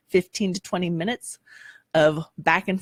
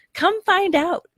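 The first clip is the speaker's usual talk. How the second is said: bright invitation; 12 semitones higher, 4 dB louder, slower than usual. Acted. Inviting callout.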